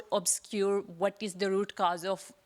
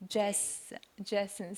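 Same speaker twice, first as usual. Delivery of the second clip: softer, halting attempt at a name; unsure; 5 dB quieter, slower than usual. Real, not acted. Questioner unsure of the panelist's name, hesitant and quiet. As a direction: feel for the name quietly, breaking off and retrying. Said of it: "sorry if I'm mispronouncing".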